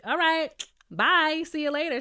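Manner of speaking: high, offhand brush-off; the king leaving his bride, breezy. Retold or imitated. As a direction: dismiss her lightly. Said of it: "he's like".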